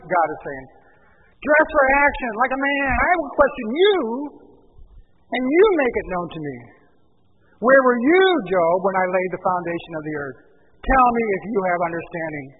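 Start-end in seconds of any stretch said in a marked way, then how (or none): 1.4-12.5 loud, higher, sarcastic challenge; booming mock challenge from God to Job; God taunting Job, loud and sarcastic. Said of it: "answered them in this sarcastic tone".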